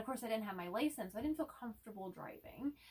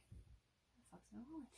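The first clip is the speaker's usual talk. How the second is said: muttered panic; 3 semitones higher, 16 dB quieter than usual. Retold or imitated. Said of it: "and I'm just like".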